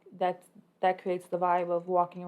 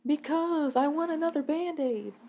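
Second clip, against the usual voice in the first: put-on higher pouty little-girl voice; imitating a whiny little girl; 8 semitones higher, 6 dB louder, slower than usual. Daughter whining to mom about her Band-Aid, higher pouty voice. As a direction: whine like a small child wanting a pink Band-Aid.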